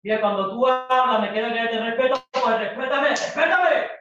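loud quarrelling, rising in pitch; shouting while denying it. Acted. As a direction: build to a shouted demand for respect. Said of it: "No te estoy gritando".

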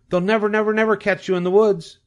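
high, excited holler; shouting goose. A narrator's reading. Goose shouting encouragement to fleeing pig, high and urgent. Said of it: "she shouted to Wilbur".

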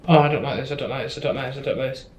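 soft frightened repeating under the breath; scared muttering. Real, not acted. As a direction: mutter it over and over, spooked. Scared muttering in horror game.

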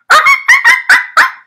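high squealing monkey chatter. Acted. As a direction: screech like excited monkeys. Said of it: "They chattered".

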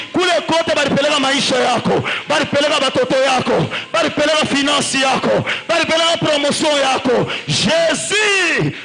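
loud, driving sermon delivery, French and Swahili; fiery preaching. Acted. Preacher rousing the congregation to rise, shouting. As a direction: preach it to the crowd at full force.

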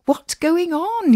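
high, drawn-out worried question; anxious. A narrator's reading. Jack walks in and asks anxiously. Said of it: "he asked anxiously".

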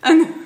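loud high burst of laughter. Real, not acted. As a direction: crack up loudly. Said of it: "I'm sorry I'm laughing".

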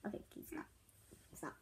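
unvoiced whispered plea; whispering. Real, not acted. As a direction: whisper it urgently. Whispered aside.

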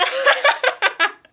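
high, loud burst of laughter. Real, not acted. Daughter bursts out laughing while trolling her mom. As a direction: crack up at the prank.

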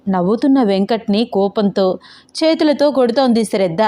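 ordinary delivery

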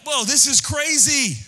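high, loud alarmed whoa. Retold or imitated. Preacher voicing the world's alarm, loud and high. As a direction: gasp at how crazy the world is. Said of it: "you're like".

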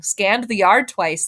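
plain reading voice

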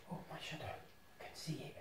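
hushed whisper. Real, not acted. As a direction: breathe it out, unvoiced.

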